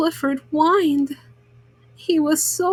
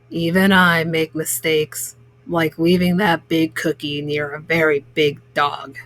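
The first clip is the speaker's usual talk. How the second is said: lower, measured baker forgiving the dog; 8 semitones lower than usual. A narrator's reading. Baker forgiving the dog, lower and measured. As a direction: think it over and let it go. Mr Bleakman admits his mistake.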